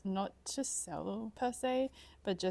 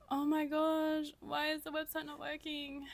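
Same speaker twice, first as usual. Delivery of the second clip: panicked; 8 semitones higher than usual. Real, not acted. Panicking over broken website launch.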